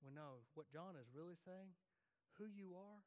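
usual speaking voice

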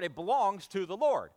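ordinary delivery

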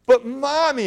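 put-on high, loud child's protest; exclaiming child. A narrator's reading. Girl protests to her angry mother, high loud exclaim. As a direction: blurt it out like a pleading girl. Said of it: "exclaimed the girl".

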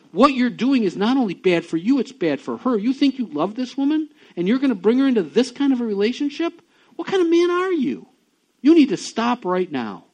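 high, loud pleading demand; urgent rebuke. Retold or imitated. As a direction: press him hard to repent. Pastor retells urging friend to repent.

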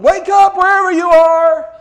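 very loud, high mocking shout; mock yell. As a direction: holler it into the sky. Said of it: "he's just yelling".

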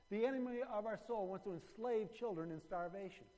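loud, high-pitched preaching push; rally the church to act against hunger; full-force preaching. Acted. Pastor urging the church to act, preaching loud.

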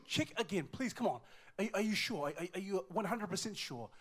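high, loud, stammering urgency; acted-out desperate disbelief; desperate pleading. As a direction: beg frantically for a different answer. Father begging the doctor to recheck.

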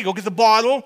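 ordinary delivery

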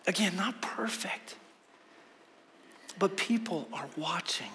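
hushed unvoiced aside to the church; whisper. Real, not acted. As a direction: drop to a whisper, people are watching. Pastor drops to a whisper.

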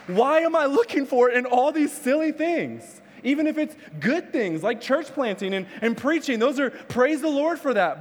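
high-pitched, fervent sermon delivery; impassioned preaching. Real, not acted. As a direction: drive the truth home to the congregation. Preaches at full force.

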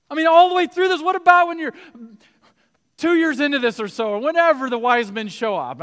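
high, loud, driving sermon voice; rising fervent preacher's call; full-force preaching. Acted. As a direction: preach it to the back row.

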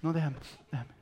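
mock tearful plea to be left alone; put-on weepy sulk; tearful pouting. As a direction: act hurt, squeezing out one tear. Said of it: "saben soltar una sola lágrima así".

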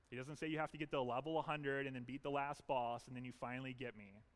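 normal talking voice

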